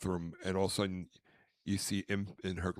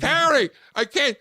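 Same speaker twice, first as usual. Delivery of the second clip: high, loud frantic shouts; panicked calling; 13 dB louder than usual. Retold or imitated. Man blinded in Big Bird suit holding baby, panicked shouting. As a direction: shout for help, unable to see.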